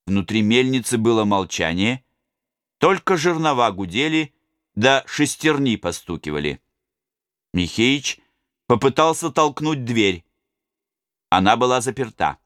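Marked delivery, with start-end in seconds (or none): none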